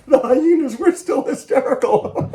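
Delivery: high, loud words giggled through; hysterical laughing. Acted. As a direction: laugh your way through it like a hyena. Hyenas still laughing.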